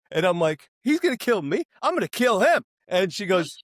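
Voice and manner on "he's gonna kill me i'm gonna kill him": high-pitched retold indignation, growing louder; indignant bravado. Boyfriend answering his girlfriend's warning, indignant bravado. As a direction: throw the threat back with mock bravado.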